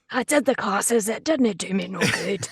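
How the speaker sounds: lightly hammed-up wrecked-voice student; Bea Arthur. Joking along as the student who didn't make it, mock impression. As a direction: play a hopeless overgrown student. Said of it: "Bea Arthur, just going".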